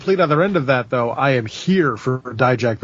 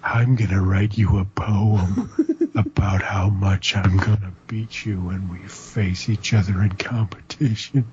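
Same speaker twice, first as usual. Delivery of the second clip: evil voice; 5 semitones lower than usual. Retold or imitated. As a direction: whisper it like a creepy villain. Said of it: "talks in an evil voice".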